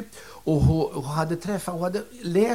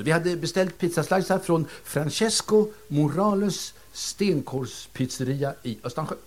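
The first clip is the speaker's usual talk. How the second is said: ordinary talk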